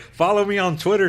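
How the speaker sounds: loud, high-pitched mock social media plug. Host teasing a guest about plugs, loud mock pitch. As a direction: call it out like a hammy plug.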